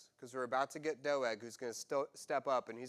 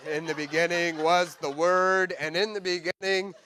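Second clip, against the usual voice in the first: mock scripture; 3 semitones higher, 10 dB louder than usual.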